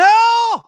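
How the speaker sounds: long high loud wail of no; anguished scream. Real, not acted. Fan watching Hull hit the bar, anguished scream. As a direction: cry out in horror at the near miss. Live reaction to Hull chance.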